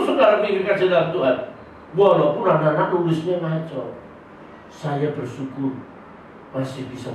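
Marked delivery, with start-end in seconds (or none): none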